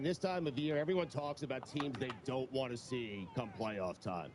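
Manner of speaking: normal talking voice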